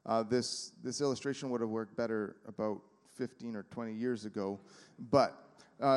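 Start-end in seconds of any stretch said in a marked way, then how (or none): none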